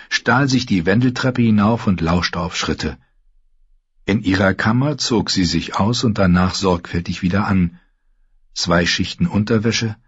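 usual speaking voice

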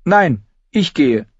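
high, slow, firm objection; contradicting. A narrator's reading. Arya contradicts Gendry, insistent. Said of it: "widersprach Arya".

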